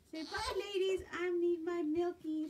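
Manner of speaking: voicing the baby. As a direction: speak for the hungry baby.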